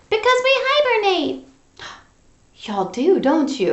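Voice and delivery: Froggy puppet voice. Pretend. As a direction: answer as the little frog.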